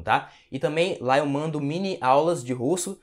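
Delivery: usual speaking voice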